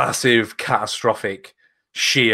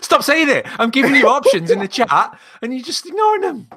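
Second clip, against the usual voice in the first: much higher, playful scolding; high teasing protest; 5 dB louder than usual. Real, not acted. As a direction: squeal it in mock outrage. Cohost teasing, high excited.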